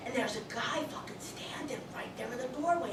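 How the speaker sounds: hushed, breathy retelling of the ghost in the doorway; whispered. Retold or imitated. Psychic to the audience, recalling the guy in the doorway, whispering. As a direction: lean in and whisper it like a secret.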